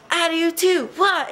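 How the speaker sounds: high mock battle cry; mock ninja cry. Friend copying the ninja yell, high and playful. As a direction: squeal it like a pretend fighter. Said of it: "she was like".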